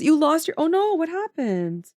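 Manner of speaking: normal talking voice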